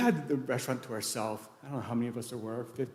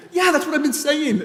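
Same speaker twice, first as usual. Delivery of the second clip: high, loud comeback; fed-up retort at full voice; imitated exasperated shout; 12 dB louder than usual. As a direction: yell it back in a mock argument. Cousin's exasperated answer, acted out.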